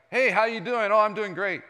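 high, loud put-on cheerfulness; fake cheerful greeting. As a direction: beam a fake church-door hello. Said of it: "most people's mask is like".

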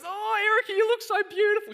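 high, loud, gushing praise; gushing friend. Retold or imitated. Ruth gushing over the wife's dress at the wedding. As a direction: gush over her like a delighted old friend. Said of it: "And she goes".

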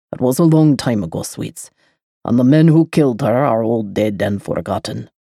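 calm her, low; low male voice. A narrator's reading. The lover comforting the girl, low.

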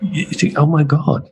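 ordinary talk

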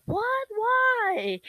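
very high mock squeal of disbelief; high, stretched-out comic outcry; incredulous classmates. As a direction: imitate a whole class baffled, voice shooting up. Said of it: "everyone in the class like".

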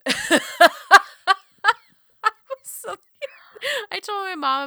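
high, broken words through laughing; helpless laughter. Real, not acted. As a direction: unable to finish for laughing. Overcome laughing at own story.

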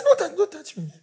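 high, loud squealing recoil with a laugh; mock squeal. Pastor squealing at a poor boy, mock disgust. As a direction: shriek it like a fussy pastor.

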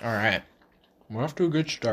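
talking while chewing; words around a mouthful. Real, not acted. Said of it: "don't mind my chewing sounds".

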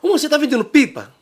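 put-on a boy asking eagerly; kid asking. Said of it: "Oi, filho". (garoto perguntando, voz aguda)

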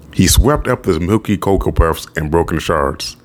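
ordinary delivery

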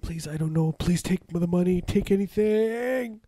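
hammed-up hostage pleading for his life; mock begging. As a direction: beg to be spared, hammed-up. Acted begging victim.

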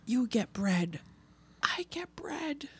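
whispered giveaway. Pretend. Riffing on giveaway bit.

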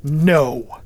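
loud, drawn refusal; shouted. A narrator's reading. Narrator refuses cousin's coaxing, shouting. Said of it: "I shouted".

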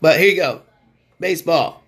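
ordinary talk, nothing marked

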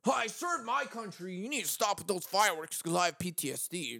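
lightly voiced aggrieved veteran; mock self-righteous veteran. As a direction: play the wounded vet card. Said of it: "start pulling the, uh, veteran card".

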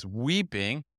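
usual speaking voice

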